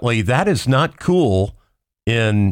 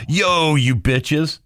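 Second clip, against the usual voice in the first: comedian impression; 6 semitones higher, much slower than usual. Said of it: "they call men bitches".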